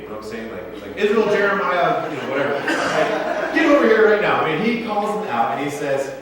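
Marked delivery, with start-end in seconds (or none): 1.0-2.1 scolding call of the full name, louder; like a parent summoning a kid by full name. Retold or imitated. God as a parent calling Israel by full name, mock scold. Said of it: "he called Israel by the middle name". 3.5-4.4 loud, higher mock scold; mock parent scolding. God as a parent calling the Israelites over, loud mock scold. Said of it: "he called Israel by the middle name".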